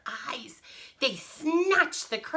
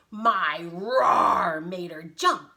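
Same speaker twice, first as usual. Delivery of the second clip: loud, slow brag; proud lion, drawn out and loud; boasting lion; 2 semitones lower, 9 dB louder, much slower than usual. Acted. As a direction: boast with a roar on ROAR. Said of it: "boasted the lion".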